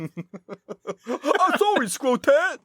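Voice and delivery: very high, drawn-out laughing apology; laughing henchman. Pretend. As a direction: grovel while cracking up.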